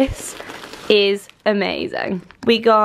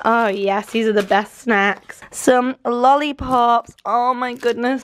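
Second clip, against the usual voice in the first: ordinary talk, nothing marked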